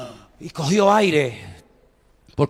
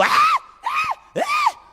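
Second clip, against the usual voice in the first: very high mock screams of fright; mock shrieks; much slower than usual. Wife screams at a cockroach at night, mock high shrieks. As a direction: shriek like someone who saw a cockroach. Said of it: "cuando oigo".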